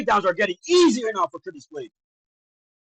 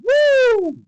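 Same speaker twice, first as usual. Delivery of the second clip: high, drawn-out "woo"; excited whoop; 14 semitones higher, 4 dB louder than usual. Real, not acted. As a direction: hold a high cheering whoop. Cheering as next round starts.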